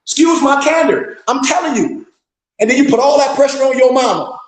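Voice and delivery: high-pitched, worked-up scolding; heated rant. Real, not acted. Scolding men who lean on their mama, heated rant. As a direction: lay into them, voice riding high.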